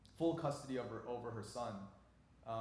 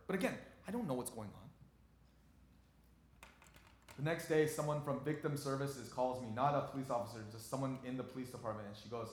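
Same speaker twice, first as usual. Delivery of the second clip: ordinary talk, nothing marked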